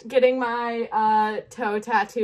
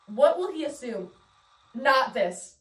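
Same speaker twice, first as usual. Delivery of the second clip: usual speaking voice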